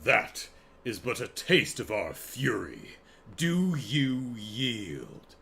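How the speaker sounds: grand, mock-regal challenge, drawn out; a solemn little king demanding surrender, very slowly; stately and slow, with a long-held final word; penguin king. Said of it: "the king is like".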